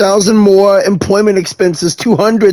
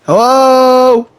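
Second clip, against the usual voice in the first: calling out; 6 semitones higher than usual. Real, not acted. Calling out into empty factory.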